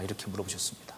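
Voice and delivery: breathy hushed whisper, voice dropped away. Real, not acted. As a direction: breathe the words out in awe. Hushed aside after God's question. (속삭이듯 조용히)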